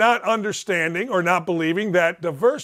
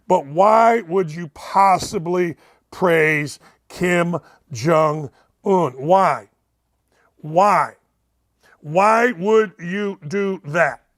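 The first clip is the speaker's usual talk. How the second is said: drawn-out, emphatic why; slow exasperated plea; slow pleading why; much slower than usual. Real, not acted. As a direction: drag out each why in disbelief. Pleading with Trump.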